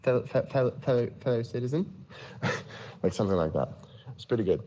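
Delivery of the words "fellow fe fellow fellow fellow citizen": put-on higher-pitched Australian accent, bumbling cop; Aussie officer fumbling through a prank call; Australian police officer. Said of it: "I did an Australian police officer".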